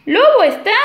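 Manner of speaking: high, loud calling out; impatient call. Acted. Animals impatiently calling the wolf.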